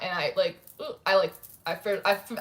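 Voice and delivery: ordinary delivery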